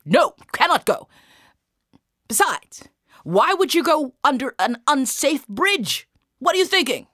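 loud, high-pitched incredulous guard; exasperated, forceful sentry; scolding soldier. Acted. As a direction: berate him at full voice.

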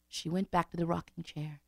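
plain reading voice

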